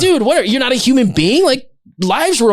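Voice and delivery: high, loud indignant scolding; hammed-up indignation at a complaining guest; mock outrage. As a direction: scold the rude diner with fake shock. Mock outrage at angry diner.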